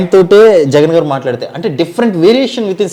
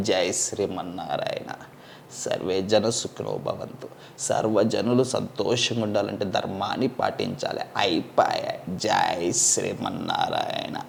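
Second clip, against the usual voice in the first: swami blessing; 9 semitones lower, 15 dB quieter, slower than usual. Retold or imitated. Spiritual guru blessing impression.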